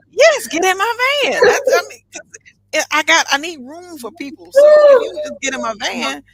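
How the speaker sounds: loud, high mock-defensive outburst; exuberant insistence. Real, not acted. Storyteller defends her van offer to amused host, exuberant insistence. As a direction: insist loudly, pitch way up.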